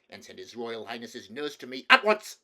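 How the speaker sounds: icy order snapping loud at the end; imperious command ending in a burst; cold voice. A narrator's reading. King summoning the nurse from a servant, cold voice. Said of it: "said in a cold voice".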